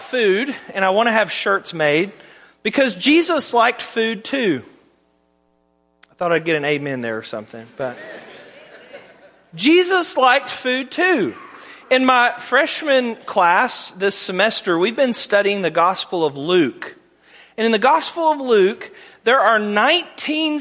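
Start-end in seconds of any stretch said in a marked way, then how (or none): none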